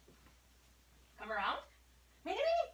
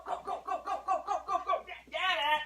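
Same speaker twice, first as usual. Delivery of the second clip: high, rapid-fire cheering on; excited urging; 9 semitones higher, faster than usual. Real, not acted. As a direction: egg the dog on in a high voice. Urging the dog on.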